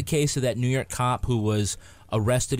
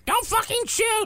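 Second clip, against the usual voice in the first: squawked parrot line, much higher. Pretend. Cohost on request squawks the parrot's line high. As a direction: screech it like a parrot. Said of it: "Do you wanna say it, [name]".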